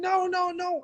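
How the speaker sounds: put-on high childish refusal; mock girl protest. Imitating Bernadette refusing. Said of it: "So Bernadette's saying".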